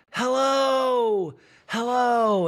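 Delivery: shouted high hello; loud high greeting. Retold or imitated. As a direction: call it out high and bright. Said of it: "And Kyle goes".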